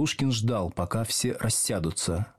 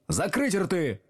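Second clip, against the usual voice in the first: raised, higher-pitched command; mild narrated shout; barked order; 7 semitones higher, slower than usual. A narrator's reading. Teacher ordering pupils to shut up, raised bark. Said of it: "гаркнул Слушкин".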